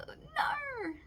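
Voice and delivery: sudden very high yelp of refusal; mock-dramatic high shout. Real, not acted. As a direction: cry out a sharp high no.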